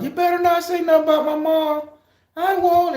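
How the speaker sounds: squeaky dog voice trading insults; TikTok dog voice. Retold or imitated. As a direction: yap it like a cheeky skit dog. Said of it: "that little TikTok skit where they have two dogs".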